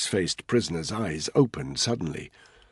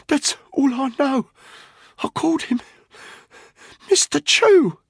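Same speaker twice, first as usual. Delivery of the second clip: high, strained breathless confession; voice cracking; 6 dB louder than usual. A narrator's reading. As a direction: gasp it out in broken pieces. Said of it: "His voice was cracking badly".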